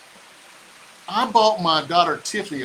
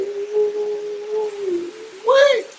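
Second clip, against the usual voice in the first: high giddy laugh. Real, not acted. Giddy laugh after stream.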